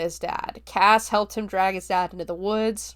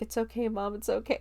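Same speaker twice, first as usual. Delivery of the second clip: soft comforting; 3 semitones higher, 7 dB quieter, faster than usual. Retold or imitated. Son comforts crying mother.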